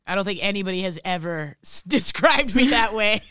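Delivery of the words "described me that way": laughing. Real, not acted. Laughs at being called calming.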